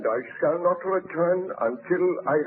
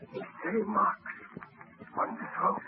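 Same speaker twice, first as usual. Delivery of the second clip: horrified hush; 6 semitones higher, 6 dB quieter than usual. Acted. Baron finds the strangling marks on the dead husband, hushed in horror. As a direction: let the discovery catch in your throat.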